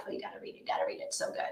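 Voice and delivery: imitating friends in a hushed whisper; whispered friends' gush. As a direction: whisper it like a secret recommendation. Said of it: "they're like".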